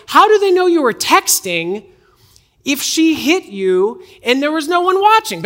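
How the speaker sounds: high, loud incredulous question; mock disbelief. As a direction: challenge the story, pitch shooting up. Mock disbelief at scammer's story.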